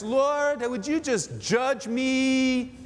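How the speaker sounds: hammed-up begging to be judged; mock prayer. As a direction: cry it out as an absurdly eager prayer. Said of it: "prayed like this".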